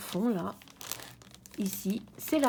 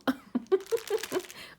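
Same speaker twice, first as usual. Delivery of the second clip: high laugh; 11 semitones higher than usual. Real, not acted. Laughs after revealing prize canvas.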